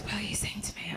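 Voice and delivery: whispered, hushed and quiet; creepy quiet whisper; soft whisper. Retold or imitated. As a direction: barely breathe the question. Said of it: "Like this".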